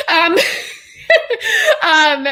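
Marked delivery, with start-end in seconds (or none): 1.1-2.2 high emotional laugh, breaking through tears; teary laugh. Real, not acted. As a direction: let a moved laugh escape, higher. Emotional laugh after praising coach.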